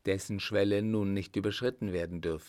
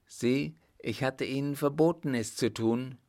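higher, mildly indignant reproach; stern rebuke; 5 semitones higher, 3 dB louder than usual. A narrator's reading. Emperor reproaches captain for disobeying, mildly indignant. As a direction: rebuke a subordinate with raised pitch.

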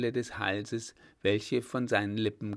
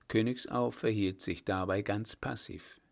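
usual speaking voice

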